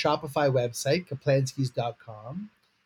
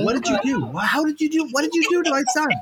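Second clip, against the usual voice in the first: mock outrage; 11 semitones higher, faster than usual. Playful mock outrage at baby.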